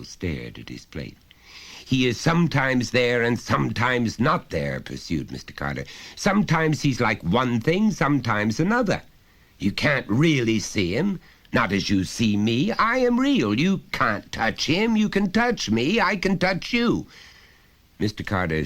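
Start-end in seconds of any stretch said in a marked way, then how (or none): 1.8-4.8 high, loud, lecturing father voice; a father talking down to his son, patiently insistent; stern father. Acted. Father pressing his small son, loud and high. 6.2-17.1 high, loud, lecturing father voice; a father talking down to his son, patiently insistent; stern father. Acted. Father pressing his small son, loud and high.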